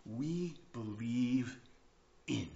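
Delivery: very slow, softened, spaced-out words; solemn declaration. Real, not acted. Preacher recites the creed's opening, slow and solemn. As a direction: pause before a deliberate "in".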